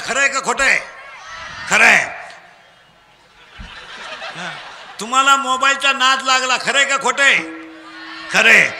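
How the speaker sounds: loud, projected rallying of a crowd; call-and-response preaching. Real, not acted. Preacher drilling children for loud answers, call-and-response preaching. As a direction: throw each phrase out for the crowd to finish.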